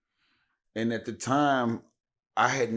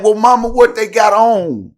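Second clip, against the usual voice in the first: high, loud urgent question to his mother; 10 semitones higher, 15 dB louder than usual. Retold or imitated. Son on the phone pressing his mother, loud and urgent. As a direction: press her for details, worried. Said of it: "So I'm like".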